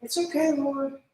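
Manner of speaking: high, sugary put-on politeness; mock the polite fake-fine prayer; fake cheerful prayer. Believer faking contentment to God, high and sugary. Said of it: "It's really silly to go to God".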